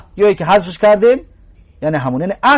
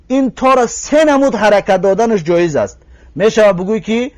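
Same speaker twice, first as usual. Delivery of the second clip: ordinary talk, nothing marked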